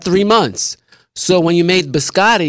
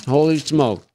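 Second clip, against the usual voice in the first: ordinary delivery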